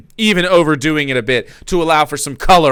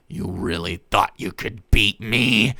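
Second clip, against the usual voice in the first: menacing villain; 4 semitones lower than usual. A narrator's reading. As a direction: taunt him coldly. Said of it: "Dave questioned Jake".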